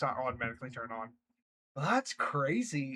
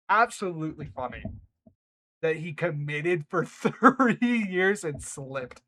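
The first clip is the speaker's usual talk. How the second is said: laughing; 8 dB louder than usual. Real, not acted. Laughing reaction.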